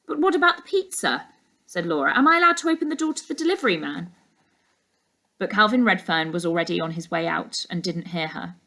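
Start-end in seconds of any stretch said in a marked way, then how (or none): 0.1-4.1 put-on higher, hurried girl's voice; young girl. Laura asking her uncle about the pizza, higher and quick. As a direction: call after him quickly, higher.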